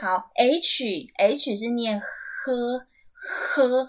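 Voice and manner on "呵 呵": "呵" with a long stream of air before the vowel; airy H sound. Shown as a demonstration. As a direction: draw out the aspirated "呵" slowly. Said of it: "好像有气音". (“呵”带气音，拉长念)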